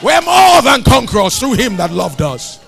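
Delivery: loud, high, triumphant declaration; full-force preaching. Real, not acted. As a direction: shout the victory out to the congregation.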